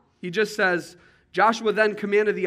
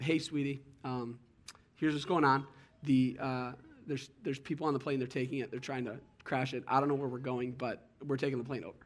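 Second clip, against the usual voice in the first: soft phone call; 4 semitones lower, 8 dB quieter than usual. Retold or imitated. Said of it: "This guy called his family".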